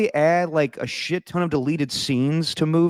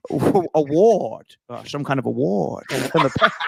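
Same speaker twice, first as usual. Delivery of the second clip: halting, slushy mock movie-star voice; hammy bad impression, close to cracking up; Connery-Stewart mix. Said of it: "mix of Sean Connery and Jimmy Stewart".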